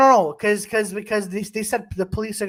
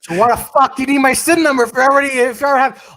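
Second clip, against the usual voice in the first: mock indignant retort; 5 semitones higher, 4 dB louder, faster than usual. Retold argument with scammer.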